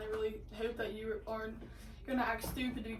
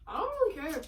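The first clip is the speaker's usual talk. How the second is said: louder and higher, stress on really; emphatic; 5 semitones higher, 5 dB louder, much faster than usual. Shown as a demonstration. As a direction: push it out, punching really. Repeats the line, louder and higher.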